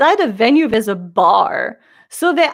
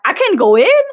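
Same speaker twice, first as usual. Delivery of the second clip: mock disbelief, higher and louder; incredulous; 7 semitones higher, 5 dB louder than usual. Friend turned away at the venue door, incredulous. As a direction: ask it as if you can't believe it. Acted quote of friend refused entry.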